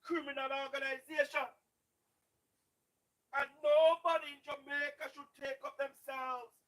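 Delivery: ordinary delivery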